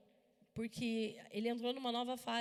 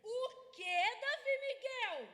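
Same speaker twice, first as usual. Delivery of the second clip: higher, mock yell from the next room; mother's startled yell; 11 semitones higher, much slower than usual. Mother calling out to her son after hearing swearing, a pitched-up mock yell. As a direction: call out sharply, pitched up. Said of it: "Eu só gritei assim".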